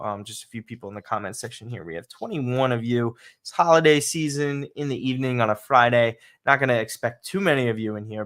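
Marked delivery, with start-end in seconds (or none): none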